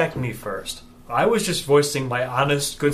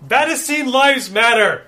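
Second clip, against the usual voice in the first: shouting; 12 semitones higher, 10 dB louder than usual. Acted. Protester chant at rally.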